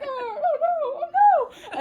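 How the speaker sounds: very high, loud comic wail; mock panic. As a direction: build a hammed-up cry of alarm. Cats panic as ice breaks.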